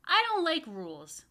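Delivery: put-on high, loud sulky child; grumpy boy. Michael to his teacher, loud and sulky. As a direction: whine it like a cross kid. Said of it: "Michael replied".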